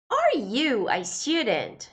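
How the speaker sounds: slow, careful English example; slow model sentence. Shown as a demonstration. Teacher reads the English example slowly to students. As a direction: spell it out slowly for learners.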